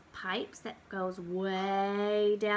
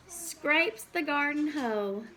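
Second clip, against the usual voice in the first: ordinary talk, nothing marked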